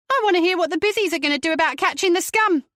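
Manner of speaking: high, loud, fast defiant rant; defiantly. A narrator's reading. Mother refusing to leave, defiantly. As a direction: stand her ground. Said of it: "she said defiantly".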